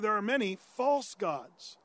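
"false" drawn out and emphasized; stress on FALSE. Real, not acted. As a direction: lean hard on the word "false". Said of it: "say that with an emphasis on the false".

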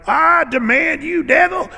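high, loud theatrical command; mocking televangelist. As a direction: ham up a TV preacher casting out the devil. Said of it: "eighties televangelists that were doing all these devil things".

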